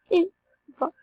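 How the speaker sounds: ordinary talk, nothing marked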